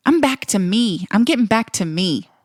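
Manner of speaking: ordinary talk, nothing marked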